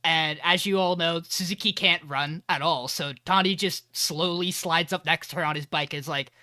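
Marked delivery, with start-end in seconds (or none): none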